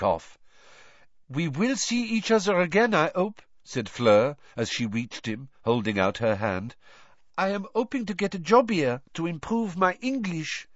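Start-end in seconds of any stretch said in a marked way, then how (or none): 1.3-3.4 put-on high, light French-accented voice. Fleur speaking with French accent. 7.4-10.7 put-on high, light French-accented voice. Fleur speaking.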